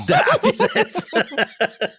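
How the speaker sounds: hammed-up Spanish accent, drawn out and sung high; slow, showy Spanish pronunciation of de Avilés. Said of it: "marks out of ten for our listeners".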